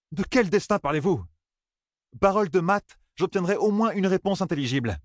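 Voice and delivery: high-pitched, heated protest; indignant refusal. A narrator's reading. Young man refusing to leave without answers, indignant.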